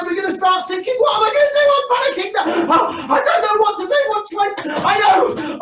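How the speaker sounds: high, loud panicked yelling; panicked shout. Acted. Frightened disciple shouting to wake Jesus in the storm, high panicked yells. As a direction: scream in terror over the storm.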